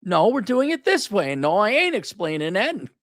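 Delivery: high-pitched, insistent mock ultimatum; bossy ultimatum. Man giving his woman an ultimatum, insistent. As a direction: lay down the law, pitch riding high. Lays down law to partner.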